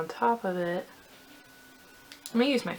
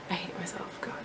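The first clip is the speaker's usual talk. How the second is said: a whispered laugh, then the words breathed out; embarrassed whisper; 12 dB quieter than usual. Real, not acted. Vlogger mocking herself to the camera, whispered. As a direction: hide the words in a sheepish whisper.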